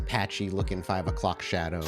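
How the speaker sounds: usual speaking voice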